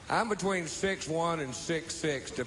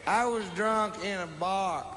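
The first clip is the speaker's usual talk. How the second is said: put-on drunken protest; 5 semitones higher than usual. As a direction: argue back like an indignant drunk. Drunken retold protest to the cop.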